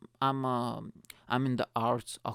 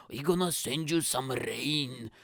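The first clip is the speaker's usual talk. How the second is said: slow, drawn-out Scottish threat; put-on Scottish accent, stretched out; Scottish gods; slower than usual. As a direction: play a vengeful Scottish god. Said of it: "the Scottish gods are like".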